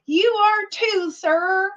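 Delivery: very high, slow, stilted delivery; starstruck awkward reply. Retold or imitated. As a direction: squeak it out slowly, imitating your own flustered self. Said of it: "That is exactly how I said it".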